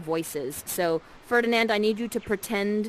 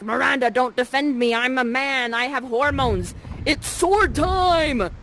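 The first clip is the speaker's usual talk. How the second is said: hammed-up manly declaration, louder; mock heroic bluster; 5 semitones higher, 6 dB louder, slower than usual. Ferdinand swaggering to Miranda before the fight, mock bravado. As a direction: puff up like a hormonal hero. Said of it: "you're like".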